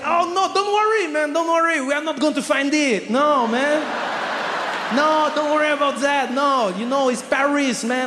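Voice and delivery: put-on very high, carefree French accent; French accent, breezily waving away the problem; cool French guy. French clerk telling traveler bag is gone, cheery French accent. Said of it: "very French, very French, cool guy".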